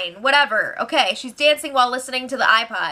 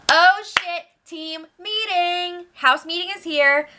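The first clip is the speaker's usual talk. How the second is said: excited; 7 semitones higher, slower than usual. Real, not acted. Host reacts to the meeting.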